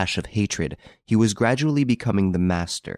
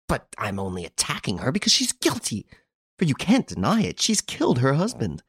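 fury; 6 semitones higher than usual. A narrator's reading. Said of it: "was choking with fury".

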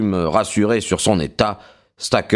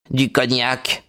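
gémissement; 3 semitones higher, slower than usual. A narrator's reading. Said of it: "gémit-il".

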